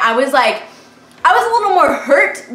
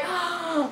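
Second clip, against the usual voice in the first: sharp gasp; 7 semitones higher, 11 dB quieter than usual. Retold or imitated.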